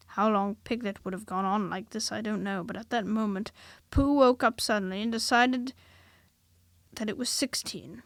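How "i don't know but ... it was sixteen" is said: ordinary delivery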